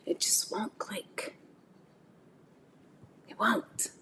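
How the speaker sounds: hushed emphatic whisper. Real, not acted. Preacher whispering the point to her viewers. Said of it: "emphatic aside".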